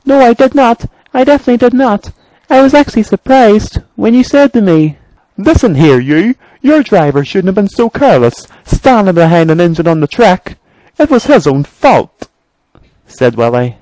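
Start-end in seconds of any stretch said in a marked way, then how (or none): 0.1-5.0 very high, anxious engine voice; high-pitched engine on the defensive; frightened engine character. Acted. Main Engine answers his threatener. 5.4-12.3 high-pitched, quick scolding; feisty little engine, voice pitched up; indignant Willie. Acted. Voices Willie standing up to Bertram.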